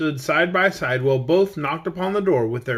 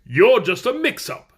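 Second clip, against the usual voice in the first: declaring; 6 semitones higher, 5 dB louder than usual. A narrator's reading. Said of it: "declared the Emperor".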